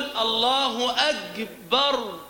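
الله أكبر said slowly as "Allahu agbar"; kaf turned hard g. Shown as a demonstration. Teacher demonstrates a distortion, kaf becomes hard g. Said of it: "يجعل الكاف جيما".

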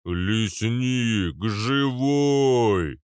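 big booming drawn-out call; swelling hooting shout; Arnold. A narrator's reading. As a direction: boom it out like a hulking giant. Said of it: "загудел Арнольд".

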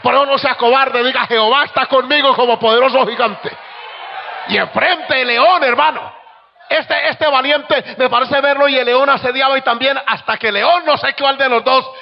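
high, loud preacher's cry; full-throated revival preaching; fiery preaching. Acted. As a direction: preach it at full force, ringing.

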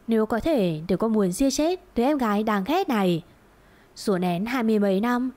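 ordinary delivery, nothing marked

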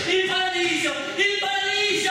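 rapturous joy. Acted. Esultanza crescente.

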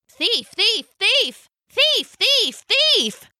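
high, chirpy chanted alarm; shrill little repeated cries; chirping penguins. A narrator's reading. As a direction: chirp it like an excited flock. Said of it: "the penguins were chirping".

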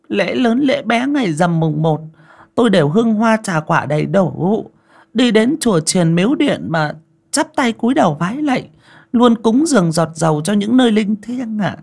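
put-on high, eager old lady rattling on; chattering old lady. A narrator's reading. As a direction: boast of her piety nonstop. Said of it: "thao thao bất tuyệt".

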